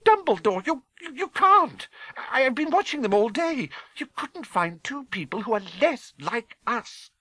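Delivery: very high, stammering outburst; scandalised, indignant disbelief; appalled cry. A narrator's reading. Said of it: "cried Professor McGonagall, jumping to her feet".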